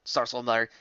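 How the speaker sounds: usual speaking voice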